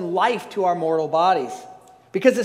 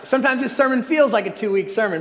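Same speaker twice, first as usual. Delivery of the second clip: ordinary delivery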